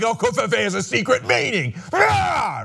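high mocking shout; mock shouting critic. Host mocking critics, high mocking shout. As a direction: yell it in a high mocking put-on voice. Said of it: "And they're like".

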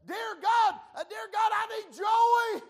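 shouted prayer. Retold or imitated. Preacher reenacting his desperate prayer, full-force shouted plea. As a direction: belt the plea up to heaven, slow on joy.

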